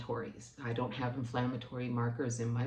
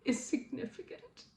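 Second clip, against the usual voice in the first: tearful defiance; 10 semitones higher, slower than usual. Retold or imitated. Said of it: "I looked at her and I said".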